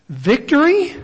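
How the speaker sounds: high rising, incredulous query; doubtful question. Real, not acted. Rhetorical question he then denies.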